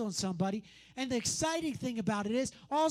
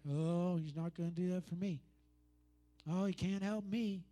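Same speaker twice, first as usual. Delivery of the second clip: lower, soft defeated whine; mopey doubt; 5 semitones lower, 5 dB quieter than usual. Pretend. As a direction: sound hopeless and small. Voicing believers' doubt.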